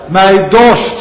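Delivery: loud, high cry of thirst. Retold or imitated. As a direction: call it out from the cross. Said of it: "Hij schreeuwt het uit". (luid en hoog uitgeroepen)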